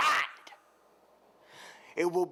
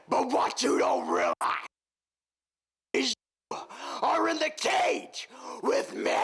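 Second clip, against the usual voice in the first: threat building to a high cry; rising shout; 8 semitones higher than usual. Acted.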